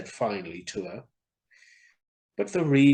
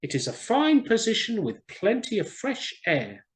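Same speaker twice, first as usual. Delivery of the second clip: higher, louder bird voice for the swallow; swallow's voice; 10 semitones higher, 3 dB louder than usual. Acted. Swallow explaining to the reed, higher and louder. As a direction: voice the swallow up high.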